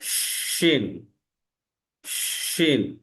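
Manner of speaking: শিন with a long drawn sh; letter শিন demo. Shown as a demonstration. Teacher introduces শিন to students, drawn out. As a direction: stretch the শ slowly. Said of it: "পরবর্তী বর্ণে যাচ্ছি".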